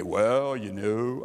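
a try at a low Southern preacher voice, dropped at once; Franklin Graham. Retold or imitated. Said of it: "he has that deep Southern voice".

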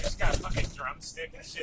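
ordinary delivery

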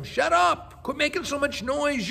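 high, sharp mock yell at the blind men; crowd yelling. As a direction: bark it like an irritated crowd. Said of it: "They yell at them".

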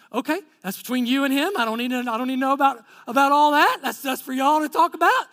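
comic, emphatic hands-off protest; flustered deflection. Retold or imitated. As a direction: hastily wave off an overshare. Backing away from tearful confession.

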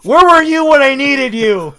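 loud, high, laughing mock reproach; laughing outcry. Real, not acted. Player laughing at the dice after a bad roll, loud mock reproach. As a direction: cry out at the dice through laughter.

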